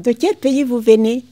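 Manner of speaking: asking questioner. Retold or imitated. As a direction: put the question up high and loud. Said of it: "quand on me demande".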